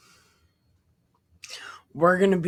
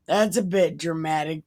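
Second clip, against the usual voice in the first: normal talking voice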